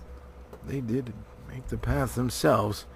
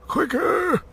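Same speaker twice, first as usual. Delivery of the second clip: urgent call; 5 dB louder than usual. A narrator's reading. Gandalf hurrying dwarves.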